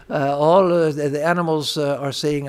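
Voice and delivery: ordinary talk, nothing marked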